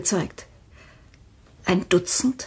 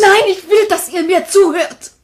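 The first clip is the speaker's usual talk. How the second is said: insistent; 9 semitones higher, 7 dB louder than usual. Acted. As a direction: demand their attention. Widow insists on being heard.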